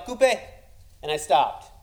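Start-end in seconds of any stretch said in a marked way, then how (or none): 0.1-0.4 higher shouted cut; a director stopping the take; director yelling cut. Retold or imitated. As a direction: call cut like a film director. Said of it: "He yelled".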